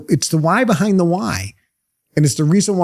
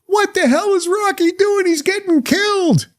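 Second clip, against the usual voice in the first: much higher, alarmed corner-man voice, worried about the fighter; a cornerman yelling in alarm as his boxer takes a beating; panicked cornerman. Retold or imitated. Rocky's cornerman watching him get beaten, alarmed, high-pitched. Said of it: "his two guys are over there going".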